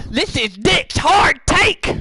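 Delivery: high, slow shouted segment name; segment title shout. Pretend. Dick Dragons shouts in his segment title, high and slow.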